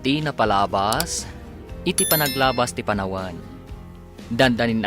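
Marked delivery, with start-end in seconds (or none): none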